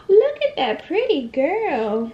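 high, slow admiring coo. Real, not acted. Admiring toddler after brushing.

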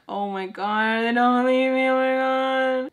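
panicked whine, held high and long; retold panic. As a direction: stretch it out in mock despair. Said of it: "I was like".

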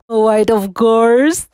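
slow, otherworldly mirror voice; magic mirror declaring. A narrator's reading. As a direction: pronounce it slowly like an enchanted mirror. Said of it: "her declared".

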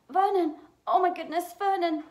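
high, loud, alarmed exclamation; shocked cry. A narrator's reading. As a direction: cry out to her husband in alarm. Aunt shocked on reading the letter.

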